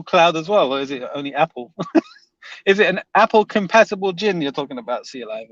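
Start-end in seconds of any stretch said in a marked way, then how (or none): none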